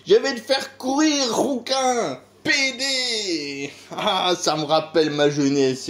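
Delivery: gleefully taunting as Le Pen; cheerful Jean-Marie Le Pen. Retold or imitated. Le Pen taunting a redhead on TV, cheerfully high. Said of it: "dit-il d'un ton enjoué".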